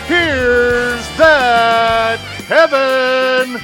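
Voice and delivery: loud, high, hugely stretched-out announcer call; big host introduction. Acted. Announcer brings Kevin on stage, long booming show-opening cry. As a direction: draw out every word to bring on the star.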